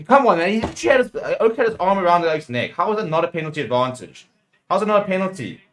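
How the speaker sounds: incredulous fan demanding an explanation; indignant protest. Real, not acted. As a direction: argue hotly with the referee. Protests a missed penalty.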